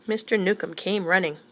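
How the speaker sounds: usual speaking voice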